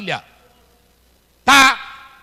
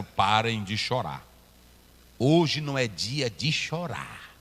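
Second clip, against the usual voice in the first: low, soft, slow command; calm levites' command; 11 semitones lower, 5 dB quieter, slower than usual. Retold or imitated. Levites calming weeping people, low and soft. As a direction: hush them gently, much lower. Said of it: "os levitas disseram".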